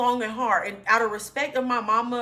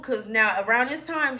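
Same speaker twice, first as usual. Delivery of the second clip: ordinary talk, nothing marked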